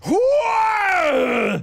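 loud, high whoa; excited shout. Acted. Co-player reacting to steroid joke, excited high shout. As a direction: yell it out in shock. Sees friend injecting steroids.

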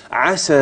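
عسى with the final long aa sounded, then stop; pausal form. Shown as a demonstration. Teacher shows students how to stop on the word, pausal form. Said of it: "ده لما وقفت".

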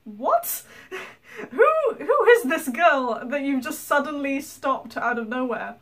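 confused, half-laughing; mock bewildered who is this; baffled family. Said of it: "the wife going".

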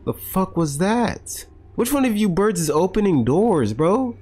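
startled. Real, not acted. Startled by noise.

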